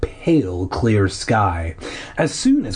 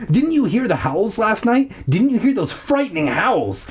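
high-pitched, worked-up questioning; losing control; 9 semitones higher than usual. Acted. Narrator to family about the howls, losing control. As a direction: plead the case with pitch raised. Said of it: "feeling myself lose control".

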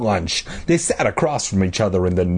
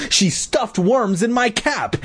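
high, slow accusation; exclaiming; 10 semitones higher, slower than usual. A narrator's reading. Boy accusing his sister to their mother, exclaiming. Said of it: "he exclaimed".

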